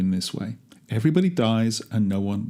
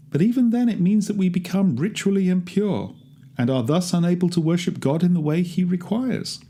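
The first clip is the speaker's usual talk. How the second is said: higher, lightly voiced rabbi explaining the law; rabbi lecturing; 6 semitones higher than usual. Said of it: "a rabbi once told me".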